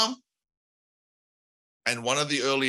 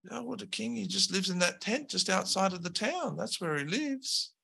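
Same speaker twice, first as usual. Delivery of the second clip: higher, softer, offhand lackey voice; slacker henchman; 8 semitones higher, 5 dB quieter than usual. Acted. Lackey telling the heroes where the king lives, high and offhand. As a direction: toss out the directions without caring.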